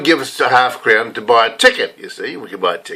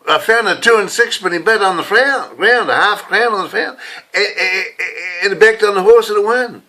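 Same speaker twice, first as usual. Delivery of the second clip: proud mum bragging; 6 semitones higher than usual. Retold or imitated. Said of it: "telling all her friends".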